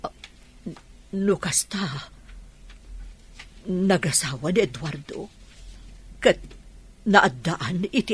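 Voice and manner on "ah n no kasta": breathy unsure whisper, slowed; hesitant whisper. Acted. Asked about her husband, she answers in a hesitant whisper. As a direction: barely voice the words, hesitating.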